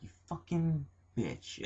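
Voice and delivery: slow, high, drawn-out sneer; mock scorn. Fan sneering at Gail Kim for leaving, slow and high. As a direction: stretch out a mock insult, up high. Fan's mock retort after she left.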